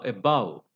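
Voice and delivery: above with stressed BOVE; accented on the root. Shown as a demonstration. Teacher lists weak-prefix words, above stressed on BOVE. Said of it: "accented on the root".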